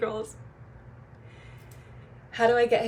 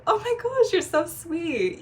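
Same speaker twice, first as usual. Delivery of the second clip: high, slow gushing praise, much higher and louder; delighted gush; 9 semitones higher, 4 dB louder, slower than usual. Retold or imitated. Sister gushing at her little brother's kind offer, delighted. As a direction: swoon over the sweet offer. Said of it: "And I'm like".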